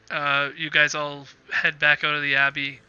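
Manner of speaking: usual speaking voice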